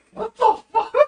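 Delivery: shocked high shout; startled yell. Retold or imitated. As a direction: blurt it out in shock. Startled by a hand reaching.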